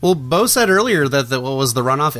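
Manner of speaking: ordinary talk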